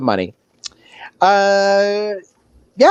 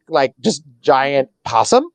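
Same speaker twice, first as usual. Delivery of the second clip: ordinary delivery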